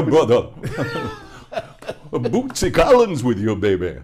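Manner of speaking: loud, laughing, high shout. Real, not acted. Joking greeting to Bootsy fan.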